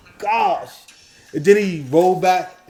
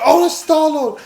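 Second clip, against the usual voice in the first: mock gushing; 12 semitones higher, 7 dB louder, slower than usual. Mocking fans greeting Star-Lord.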